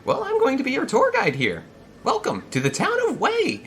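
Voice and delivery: very high, loud put-on greeter voice; eager falsetto hail across a distance; Cap. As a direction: call out in a high, strained character voice. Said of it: "let's see if I can do his voice".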